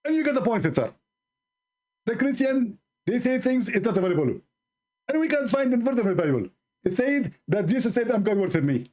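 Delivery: high, squeezed mocking preacher; hammed-up pinched preacher, lisping as if toothless; Zakir Naik impression. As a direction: push the words out in a strained high voice. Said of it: "he squeezed the word coming".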